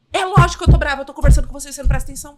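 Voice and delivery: loud and fast, annoyed scolding; retold angry outburst; exasperated mother. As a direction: snap back like a fed-up mom.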